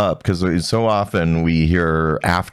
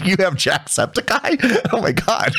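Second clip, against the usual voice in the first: high, slow astonished question; high mock horror; mock alarm; 12 semitones higher than usual. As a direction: gasp at the diagnosis, high and slow. Mock alarm at funny name.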